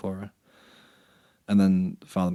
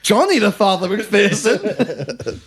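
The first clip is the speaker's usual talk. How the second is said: much higher, loud, drawn-out cartoon exclamation; hammed-up, slow and high; Perry the Platypus gag; 13 semitones higher, 10 dB louder, much slower than usual. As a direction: shout the full name as a mock reveal. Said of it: "deepest Perry the Platypus transformation".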